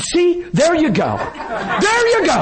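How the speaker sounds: high, laughing there you go; hammed-up mocking wife, laughing; mocking woman's voice. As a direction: imitate her teasing with a laugh. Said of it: "She said".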